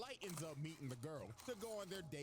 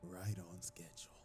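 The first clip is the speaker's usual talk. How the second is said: much lower, emotionless murmur; cold whisper; 9 semitones lower than usual. Acted. Light checking his watch, low cold whisper. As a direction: state it coldly like a mastermind. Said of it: "with no emotion ... and said".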